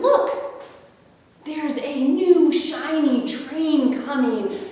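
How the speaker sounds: a clown pointing out hope; excited clown. Acted. The clown calling to the toys, high and excited. Clown spots a rescue train.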